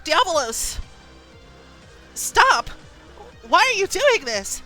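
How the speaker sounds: high, loud panicked pleading; distraught cry. Acted. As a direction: cry out to a runaway horse. Rider pleading with runaway horse.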